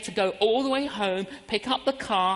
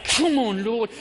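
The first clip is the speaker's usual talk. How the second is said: urgent pleading, pushed louder; pressing prayer, louder and intense; fervent inner prayer; 3 semitones higher, 5 dB louder than usual. Retold or imitated. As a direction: plead with the Lord in earnest. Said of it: "I was like".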